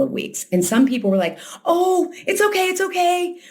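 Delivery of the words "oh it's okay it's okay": very high, placating sing-song; overdone high-pitched comforting; mock soothing. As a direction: imitate someone hastily hushing grief. Voicing people who hurry grief away.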